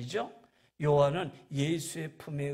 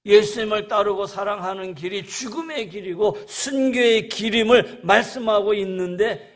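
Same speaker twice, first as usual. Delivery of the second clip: loud, raised preaching voice; forceful preaching; 4 semitones higher, 10 dB louder than usual. Real, not acted.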